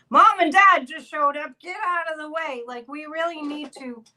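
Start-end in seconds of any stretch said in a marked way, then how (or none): 0.0-2.6 high, loud stern parent bursting in; louder, higher parent clearing the room; a hammed-up parent taking charge. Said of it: "a surge of people just saying".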